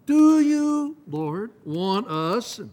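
loud high slow eager question; eager plea. Acted. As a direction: burst out, then draw it out. Disciples asking again.

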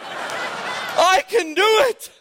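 high, stretched-out eager cry; excited. Retold or imitated. Retelling his eagerness in the fire truck, excited. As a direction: act out a thrilled would-be fireman. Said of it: "I was excited".

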